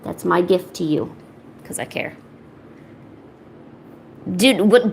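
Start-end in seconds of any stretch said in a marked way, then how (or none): 1.6-2.2 whispered aside. Acted. Mock tender aside to viewer.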